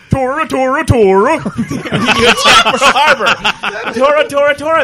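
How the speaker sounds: loud high laughing battle cry. Pretend. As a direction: yell it like a charge, cracking up.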